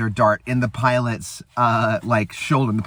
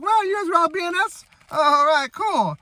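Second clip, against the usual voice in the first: high falsetto wonder; hammed-up imitation of an impressed character; mock amazement. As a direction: marvel in a put-on, high-pitched voice. Retold character's amazed reaction.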